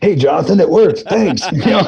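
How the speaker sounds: high, loud, bursting with joy; excited. Retold or imitated. Leaving a thrilled voicemail for his teacher, excited. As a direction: gush the good news into the phone.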